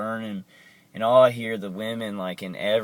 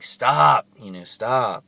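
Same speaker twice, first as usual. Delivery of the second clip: sudden loud shout; 3 semitones higher, 7 dB louder, slower than usual. Retold or imitated. Man yelling at unseen attackers to stop. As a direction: yell stop at the tormentors.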